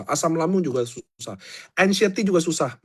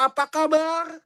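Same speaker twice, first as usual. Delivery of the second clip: mock sweet greeting; 10 semitones higher, slower than usual. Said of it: "mampus nggak?".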